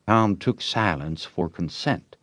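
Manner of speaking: plain reading voice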